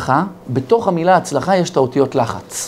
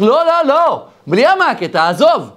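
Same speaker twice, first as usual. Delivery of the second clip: instructor's shout; 10 semitones higher than usual. Retold or imitated. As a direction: call it across the pool, high and urgent. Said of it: "צועק לו המדריך".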